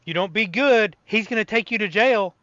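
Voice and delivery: a hint of a scolding parent; warning parent. Parent warning child about uniformed officer, mock threat.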